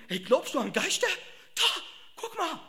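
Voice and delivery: high, excited ghost-spotting voice; spooked fisherman. Acted. Fisherman nudging a mate at a ghost, high excited voice. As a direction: point it out like a spooked sailor.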